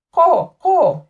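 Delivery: loud, high, hoarse cawing; hoarse crow caw. A narrator's reading. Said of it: "remarked the crow hoarsely".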